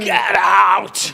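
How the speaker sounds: loud playful get out; mock shout. Actor jokingly yelling at a fan, mock shout. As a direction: shout it in jest.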